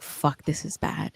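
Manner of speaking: whispered under the breath; hushed, frightened whisper; dread whisper. Retold or imitated. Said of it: "I cannot forget the feeling of".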